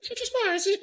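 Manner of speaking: put-on very high, overdone British accent; hammed-up British lady accent; bad British accent. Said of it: "worst British accents ever".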